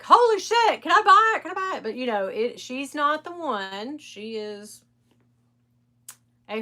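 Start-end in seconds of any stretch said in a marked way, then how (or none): 0.0-1.8 much higher, louder eager plea; eager viewers. Retold or imitated. Viewers seeing the bag, higher and eager. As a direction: beg to buy it, thrilled. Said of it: "everybody's like".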